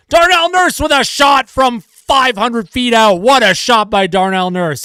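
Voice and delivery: hammed-up excitement dripping with sarcasm; sarcastic praise. Commentator mocking a harmless long shot with fake hype. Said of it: "the sarcasm in my voice".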